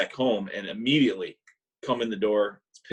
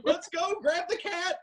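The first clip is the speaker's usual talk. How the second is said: frantic mom; slower than usual. Retold or imitated. Mom hurrying son out.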